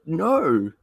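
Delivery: ordinary delivery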